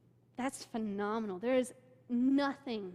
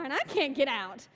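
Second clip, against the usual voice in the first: ordinary talk, nothing marked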